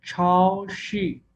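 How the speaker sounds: very slow Mandarin syllables; Chinese pronunciation demo. Shown as a demonstration. Teacher models Mandarin for the class, very slow. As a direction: stretch the Chinese word out for students. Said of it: "teacher demonstrates Chinese word".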